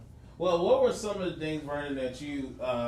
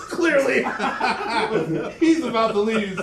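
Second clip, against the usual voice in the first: higher, louder, laughing through the words; laughing tease; 5 semitones higher, 8 dB louder than usual. Real, not acted. Sibling teasing a rival about losing, laughing.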